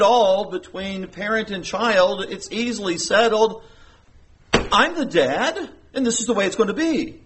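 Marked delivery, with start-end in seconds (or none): 4.5-7.2 higher-pitched, insistent parental decree; stern dad, nearly yelling. Retold or imitated. Father settling a dispute with his child, stern and insistent. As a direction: lay down the law, holding back a yell. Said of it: "I almost yelled there, but I didn't".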